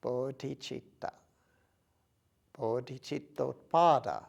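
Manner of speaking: measured phrases with long, spacious pauses; unhurried contemplative recitation, phrase by phrase; guided meditation. Acted. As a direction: guide them gently, leaving silence between phrases. Leads a meditation.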